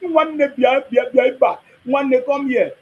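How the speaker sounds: put-on high, loud Igbo-accented call to a brother; Igbo accent imitation. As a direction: call out like an Igbo man, Nwanne, bia. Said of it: "Have you listened to Igbo man speak?".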